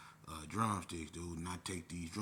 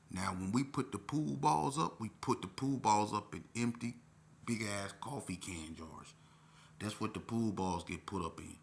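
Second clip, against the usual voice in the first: ordinary delivery, nothing marked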